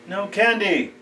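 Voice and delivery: high-pitched yell toward the door. Acted. Joe to late-night trick-or-treaters at his door, high yell. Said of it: "Joe yells out".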